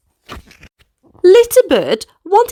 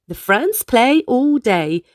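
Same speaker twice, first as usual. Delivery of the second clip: plain reading voice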